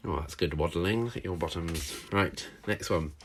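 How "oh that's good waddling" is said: ordinary delivery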